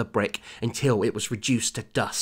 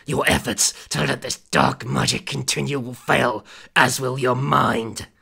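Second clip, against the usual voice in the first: demon voice; 3 dB louder than usual. A narrator's reading. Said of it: "demon threat continues".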